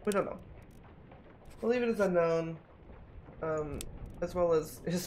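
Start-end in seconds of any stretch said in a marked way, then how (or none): none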